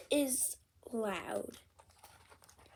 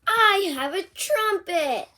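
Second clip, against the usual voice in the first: high, loud, thrilled announcement; Piggy bursting to show off; excited pig; 5 semitones higher, 6 dB louder than usual. Acted. Piggy shows Gerald her trumpet, loud and thrilled.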